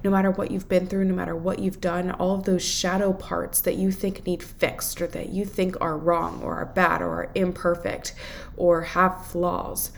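normal talking voice

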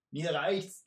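loud and impatient threat; hissed, fed up. Acted. Archer hisses to his partner to finish the messenger. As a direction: end the game, fed up and cruel. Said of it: "zischte der Bogenschütze".

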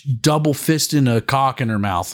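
normal talking voice

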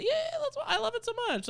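high, gushing fake eagerness; feigned enthusiasm. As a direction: gush far too eagerly to please a friend. People pleaser feigning enthusiasm.

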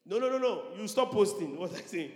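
high, loud hammed-up no no no; comic frantic denial; mock panic. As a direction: play the scared believer in a panic. Acting panicked believer deleting posts.

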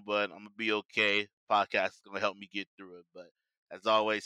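ordinary talk, nothing marked

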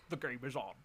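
a hint of Sean Connery looking into the camera; bad Connery catchphrase; Sean Connery impression. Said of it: "It's Sean Connery".

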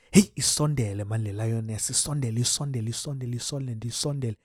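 low, hushed coaxing as if not to scare the prey; hushed urging. Retold or imitated. Cheering on a stalking lioness in a hush. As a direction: egg her on under your breath.

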